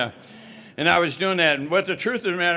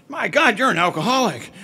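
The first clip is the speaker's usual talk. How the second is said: shocked exclamation; 5 semitones higher, 4 dB louder than usual. Retold or imitated. Counselor shocked by test results.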